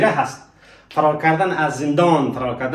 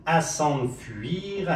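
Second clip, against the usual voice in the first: slow, softer, stretched-out syllables; very slow model; 5 dB quieter, much slower than usual. Shown as a demonstration. Teacher repeating the phrase for learners to try, very slow. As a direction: draw out each syllable for learners.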